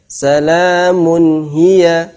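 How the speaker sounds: Salamun hiya slow and flowing; smooth recitation. Shown as a demonstration. Teacher showing correct smooth reading. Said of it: "Tetap bacanya lentur".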